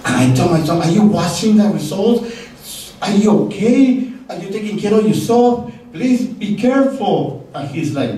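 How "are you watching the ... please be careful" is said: a worried dad fretting over the phone, half laughing; nervous father. Retold or imitated. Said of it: "I'm getting so nervous".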